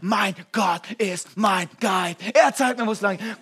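full-throated shouted creed; with conviction. Shown as a demonstration. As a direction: shout it like you mean it. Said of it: "Sag's mal mit Überzeugung".

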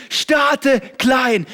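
"starte klein" shouted high and loud; "starte klein" yelled like a rally cry; shouted slogan. Shown as a demonstration. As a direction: hammer "starte klein" out at full force. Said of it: "repeated slogan".

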